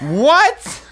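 loud, high yelp of disbelief; shocked. Real, not acted. Hearing a wild TV spoiler, shocked. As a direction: blurt it out, stunned.

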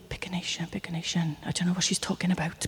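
whispered in a rush to herself; panicked whisper. Retold or imitated. Woman at prayer meeting whispering to herself, lost. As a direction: hiss it under your breath, flustered. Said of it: "And I think".

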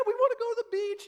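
put-on high, fast, squealing kids' voice; excited kids. Kids cheering to dad, high excited squeal. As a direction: imitate the kids cheering for the beach. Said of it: "they're all excited".

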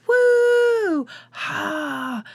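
mock audience whoop, high and far off; fake crowd cheer. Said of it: "That's an audience, not me".

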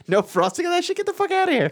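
high squeaky outrage; outraged disbelief. Retold or imitated. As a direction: scoff in mock indignation.

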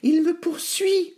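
very high, slowed piglet squeal; panting piglet. A narrator's reading. Nif Nif chased to his brother's house, breathless alarm. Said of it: "dit Nif Nif en haletant".

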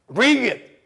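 battle challenge. Acted. Fighter daring his problems to come, first battle challenge. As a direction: call the fight on.

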